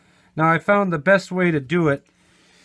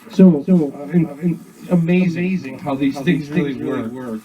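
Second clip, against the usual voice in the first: talking through the echo; 4 dB louder than usual. Shown as a demonstration.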